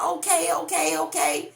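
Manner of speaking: high, impressed okay okay. Retold or imitated. Onlookers reacting to the couple, impressed and high. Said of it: "They like".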